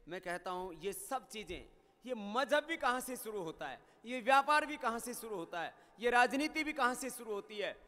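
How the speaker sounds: ordinary delivery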